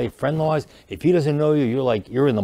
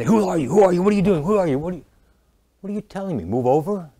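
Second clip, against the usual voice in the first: mock freak-out; 7 semitones higher than usual. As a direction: play the bodyguard panicking at a stranger. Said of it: "I freak out as, as the bodyguard".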